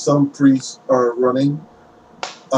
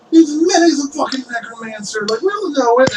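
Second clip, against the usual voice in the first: high-pitched character voice, softening; accusing townsperson; 10 semitones higher than usual. Acted. Townspeople about the necromancer, high accusing voice. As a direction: protest in a voice pitched well up.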